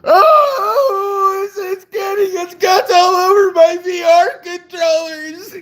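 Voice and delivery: high, loud wailing child impression; imitating upset kids. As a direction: shriek in horror like a distraught kid. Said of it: "the kids were just pricelessly".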